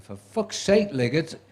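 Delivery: high and loud exasperated yell, slowing on "cue commentator"; furious shout. Retold or imitated. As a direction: bellow it like a fed-up director losing patience. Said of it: "the next time he shouted".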